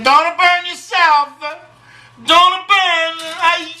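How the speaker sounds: high, drawn-out falsetto cry; hammed-up slow, high-pitched caution; comic warning. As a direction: wail the warning in mock alarm. Clowning warning while loading the oven.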